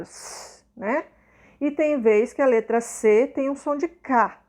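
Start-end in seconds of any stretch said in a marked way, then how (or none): 0.0-0.6 a long unvoiced hiss of "s"; teacher demonstrating the blown s to the class; blown s sound. Shown as a demonstration. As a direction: blow the s out with no voice. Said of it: "Aquele som soprado".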